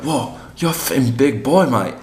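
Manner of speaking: impressed English bloke, high and loud; English accent. Retold or imitated. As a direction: imitate an amazed Englishman, high-pitched. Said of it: "I'm from England".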